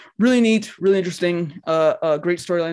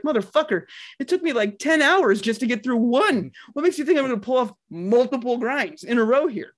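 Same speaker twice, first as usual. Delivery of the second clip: exasperated; 7 semitones higher than usual. Retold or imitated. Retells his reaction to a tutorial.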